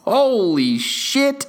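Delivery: high laugh into slow exclaim; laughing holy shit; laughing amazement. Real, not acted. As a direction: laugh in disbelief.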